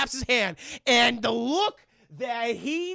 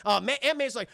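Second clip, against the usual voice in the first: ordinary delivery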